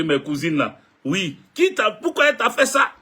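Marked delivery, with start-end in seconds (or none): 1.5-2.9 much higher, indignant voice of the sisters; mock outrage, ready to come over; sisters' voice. Retelling his sisters and cousins on the phone, much higher and outraged. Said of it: "Mes soeurs, mes soeurs même m'ont appelé".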